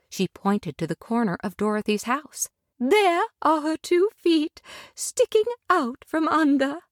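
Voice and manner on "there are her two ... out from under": tender lady. Acted. Glinda tenderly welcoming Dorothy to Munchkin Land. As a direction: welcome her gently and graciously.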